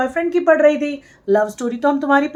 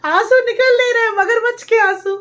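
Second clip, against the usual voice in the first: higher, sneering jab at the fake tears; mocking scorn; 9 semitones higher, 3 dB louder than usual. Real, not acted. Scoffing at a vlogger's crocodile tears, high mocking voice. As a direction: point and scoff, pitch up high.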